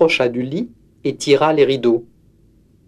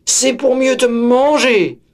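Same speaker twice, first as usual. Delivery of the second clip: high, loud slow wolf pounce; wolf springing on the girl, high and loud; menacing wolf; 9 semitones higher, 3 dB louder, slower than usual. Acted. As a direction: roar it as you leap.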